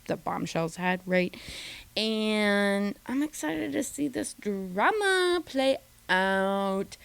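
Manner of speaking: usual speaking voice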